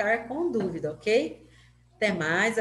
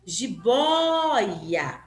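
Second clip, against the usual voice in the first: "Jiboia" with a long, loud, raised "bói"; stressed vowel demo; 4 dB louder than usual. Shown as a demonstration. Teacher reading the example aloud, exaggerated stress on "Jiboia". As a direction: swell on the Ó of "Jiboia", then fall away on "ia". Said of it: "na hora que eu vou fazer a leitura".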